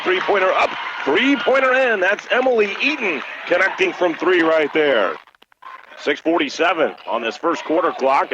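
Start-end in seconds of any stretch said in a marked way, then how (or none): 0.0-5.2 rising excitement as the three drops in; excited call. Real, not acted. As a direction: call the first basket with a burst of energy. Three-pointer drops for first points.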